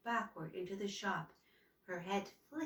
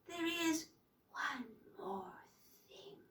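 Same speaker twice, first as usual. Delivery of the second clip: unvoiced, witchy whisper; dry whisper; 6 semitones higher, 6 dB quieter than usual. A narrator's reading. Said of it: "The voice was a dry whisper".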